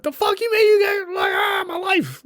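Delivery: high-pitched imitation of a frantic yell; mock meltdown, voice shooting way up; freaking out. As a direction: wail it like a man losing it. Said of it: "he started fucking freaking out".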